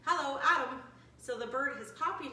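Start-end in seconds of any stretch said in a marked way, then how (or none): 0.1-0.8 louder, higher phone greeting; a hint of the owner's phone hello; shop owner answering. Shop owner picking up the phone, lightly voiced, brighter and louder. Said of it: "he says".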